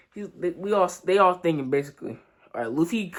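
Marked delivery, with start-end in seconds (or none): none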